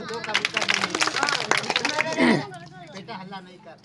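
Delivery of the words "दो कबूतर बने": very slow, loud drawn-out reveal; milk the reveal, stretching every word; showman announcement. Real, not acted. Magician revealing two pigeons to the crowd, drawn-out loud announcement.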